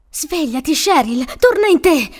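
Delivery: plea building to a loud, high cry; urgent, desperate call; desperate urging. A narrator's reading. Frank desperately trying to wake her.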